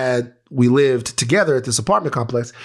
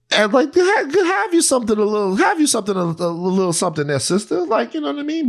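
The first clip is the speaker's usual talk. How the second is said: high pleading voice asking for a little something; playful high wheedling ask; mock begging; 9 semitones higher than usual. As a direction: sweet-talk her for cash, pitched up.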